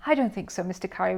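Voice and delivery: ordinary delivery, nothing marked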